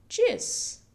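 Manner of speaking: Tschüss said high and breathy, sharp sch; crisp model word. Shown as a demonstration. Teacher modelling Tschüss for learners. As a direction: give Tschüss a quick bright hiss.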